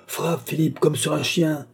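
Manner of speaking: high-pitched furious command; heated order, pitch well up; exclaiming. A narrator's reading. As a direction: urge the blow on, enraged. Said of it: "s'écria le baron".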